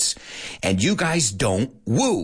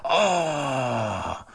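disappointed oh; 2 semitones higher than usual. Shown as a demonstration. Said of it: "That's how you guys say".

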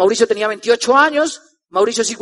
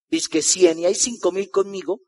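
skeptical inner thought; 7 semitones lower, 8 dB quieter than usual. Retold or imitated. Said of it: "yo decía".

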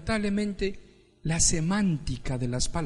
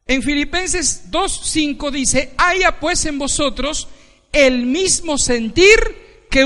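loud, high sermon build; full-force preaching; 7 semitones higher, 8 dB louder, slower than usual. Acted. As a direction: drive each phrase home from the pulpit.

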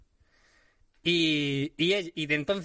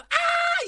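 imitated scream; 5 dB louder than usual. Mimics Bella screaming again.

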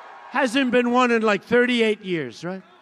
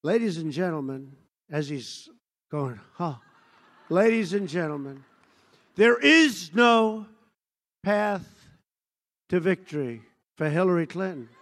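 hushed announcer voice; 4 semitones lower, 3 dB quieter than usual. Retold or imitated. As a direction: draw it out like a reluctant anchor. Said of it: "and he goes".

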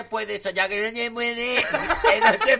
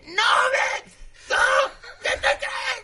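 outraged queen shriek; slower than usual. Pretend. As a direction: scream who do you think I am. Reina indignada. (reina furiosa gritando que es la reina)

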